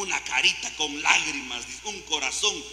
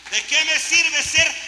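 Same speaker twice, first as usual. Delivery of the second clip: high, loud, slow challenge; rhetorical cry; 9 semitones higher, 7 dB louder than usual. Real, not acted. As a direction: demand it of the crowd.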